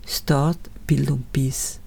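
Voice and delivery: ordinary talk, nothing marked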